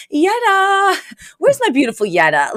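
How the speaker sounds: high sing-out of the name, then coaxing; wheedling call. Retold or imitated. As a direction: imitate someone sweetly calling out to score an invite. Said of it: "she wanders out going".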